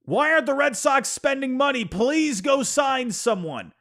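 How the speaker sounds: hammed-up desperate fan begging; mock meme scream. Reading his meme caption as a whiny fan, mock pleading. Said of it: "the first caption I have says".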